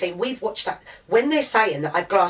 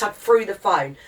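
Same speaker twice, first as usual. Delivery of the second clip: ordinary talk, nothing marked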